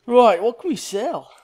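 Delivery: put-on slow, loud Australian accent; loud, slow mock Australian accent. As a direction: drawl it out like an Aussie. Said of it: "Oh, that's an Australian accent".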